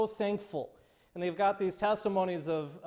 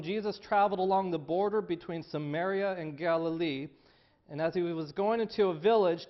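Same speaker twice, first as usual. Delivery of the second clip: normal talking voice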